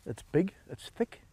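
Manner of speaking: usual speaking voice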